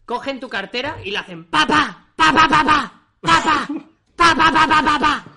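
shouted staccato pa pa pa; loud rapid pa-pa noises. Pretend. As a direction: fire off pa sounds loudly. Mimicking wallet being emptied. (onomatopeya gritada pa pa pa)

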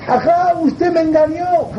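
high, wailing accusation; tearful complaint. Acted. Crying student accuses his rabbi of cheating him, high wail.

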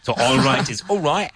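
all right in a Cockney accent, vowels shifted. Shown as a demonstration. Teacher demonstrates the Cockney accent to students. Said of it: "the classic Cockney accent".

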